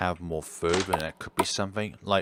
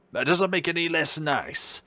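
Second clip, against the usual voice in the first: higher, delighted doctor voice; raised-pitch doctor admiring the room; doctor character voice; 9 semitones higher than usual. Acted. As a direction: gush about it like the genteel doctor. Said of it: "I'm gonna drop this voice".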